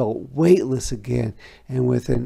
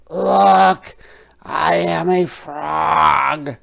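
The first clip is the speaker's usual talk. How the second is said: higher, louder frog voice, slow and proud; triumphant frog; 5 semitones higher, 8 dB louder, slower than usual. A narrator's reading. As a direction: proudly declare you're a frog. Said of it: "he said triumphantly".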